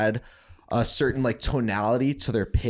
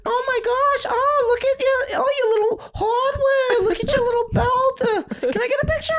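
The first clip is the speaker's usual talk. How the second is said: squealing falsetto, imitating gushing fans; gushing Jersey girls; 4 dB louder than usual. Jersey girls fussing over his belts, gushing falsetto. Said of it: "All the girls are like".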